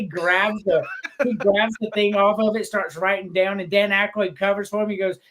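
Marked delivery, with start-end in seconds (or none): none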